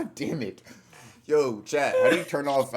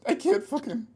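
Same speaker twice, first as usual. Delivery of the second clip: mock anguish; 9 semitones higher than usual. Hammed-up stomach pain.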